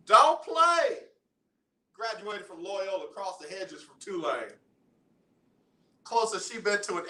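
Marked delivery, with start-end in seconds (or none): 0.1-1.0 sudden high, loud playful "Don't play."; mock scolding. Real, not acted. Teasing caller, playful scolding.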